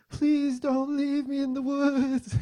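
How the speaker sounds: slow, high, loud wail; crying little kid. Retold or imitated. Son afraid of being left in woods, imitated crying. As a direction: drag it out like a bawling toddler, hammed up.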